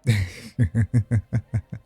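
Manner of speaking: amused, rippling chuckle; teasing laugh. Acted. Demon who just caught companion with innuendo, teasing laugh. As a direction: laugh at your own innuendo.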